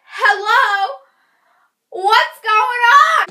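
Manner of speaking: loud, high, slow exasperated hello; impatient call. Retold or imitated. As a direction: call out fed up after hours of waiting. Waiting two hours in ER.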